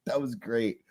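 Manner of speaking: normal talking voice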